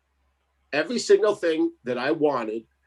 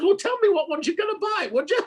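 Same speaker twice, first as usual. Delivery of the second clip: mock wife voice.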